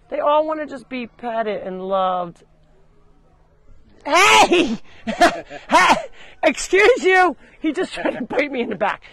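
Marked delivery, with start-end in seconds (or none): 4.0-5.9 sudden high, loud yelp; startled yell at a goat; startled shout. Real, not acted. Goat bites her back. 6.4-7.4 high, loud affronted yell; indignant telling-off; indignant shout. Real, not acted. Goat tried to bite her.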